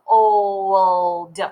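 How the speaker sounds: put-on old drawn out so every sound is heard; stretched slow. Shown as a demonstration. Teacher to young readers, slowly stretching the word. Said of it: "If I say it really slow".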